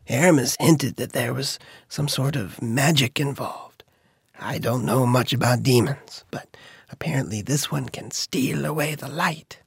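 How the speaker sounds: secretive whisper. A narrator's reading. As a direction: hush it like a secret. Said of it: "Red had been whispering".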